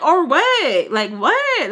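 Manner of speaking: loud, high retort, re-enacted; fed-up challenge. Talking back to brothers' dad, fed-up and loud. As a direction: throw it back as an irritated dare. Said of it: "I'm just so, like, ugh".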